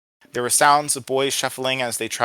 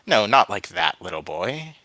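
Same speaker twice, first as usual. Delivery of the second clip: usual speaking voice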